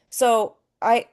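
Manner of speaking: ordinary delivery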